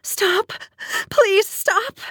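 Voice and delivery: very high, desperate begging; anguished, pitch-leaping plea; sobbing plea. A narrator's reading. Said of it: "I sobbed out his name".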